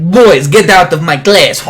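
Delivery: loud, high, slow mock French scolding; poor French accent. Imitated teacher throwing boys out, poor French accent. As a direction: yell in a badly faked French accent. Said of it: "trying to do very poor French accent".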